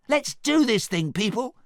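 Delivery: high, loud rallying shout; Donkey. A narrator's reading. Donkey rallies his team, shouting high. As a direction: shout it high to rouse the troops. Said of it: "Donkey shouted".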